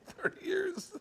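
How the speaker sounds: very high, drawn-out imitation; squeaky slow mock protest; mock indignation. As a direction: stretch it out, mimicking his outrage. Mimics the man's indignant refrain.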